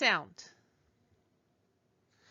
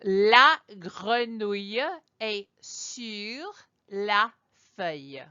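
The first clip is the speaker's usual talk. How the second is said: very slow French, word by word; slow French demonstration; 3 dB louder, slower than usual. Shown as a demonstration. Teacher saying the frog sentence out loud for learners, slow French. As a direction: model the French sentence slowly with pauses. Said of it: "I will say it out loud".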